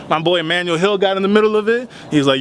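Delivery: ordinary delivery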